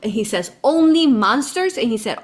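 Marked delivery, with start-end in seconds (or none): 0.7-1.8 high, loud, forceful; emphatic quote. Retold or imitated. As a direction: drive the point home. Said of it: "his exact words he said in Spanish".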